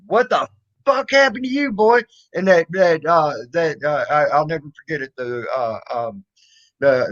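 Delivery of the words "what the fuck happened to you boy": high, startled mother's cry; mother bursting out at the sight of blood; mom's shocked outburst. Retold or imitated. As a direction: retell mom's alarm, raised pitch. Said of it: "and Mom said".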